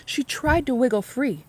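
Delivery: ordinary delivery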